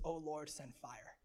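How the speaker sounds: soft, slow, low plea; calm acted prayer, hushed and unhurried; understated prayer. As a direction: pray it simply and quietly. Elijah's simple prayer, against Baal's shouting.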